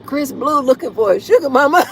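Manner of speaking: real laughter through the words; laughing. Real, not acted. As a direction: giggle it out, amused. Laughing at the comment.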